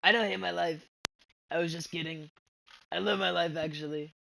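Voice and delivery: a hint of Trump, pushed up and loud. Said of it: "Talking in this Trump voice".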